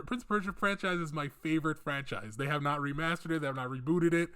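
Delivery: ordinary talk, nothing marked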